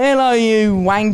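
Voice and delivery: slow, hammed-up British greeting; mock British accent. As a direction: put on a broad British accent. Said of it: "That's how I sound".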